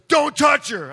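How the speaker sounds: stern warning. Retold or imitated. Doctor snapping at the husband to back off. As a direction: warn him off firmly. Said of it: "the doctor looks at me and just goes".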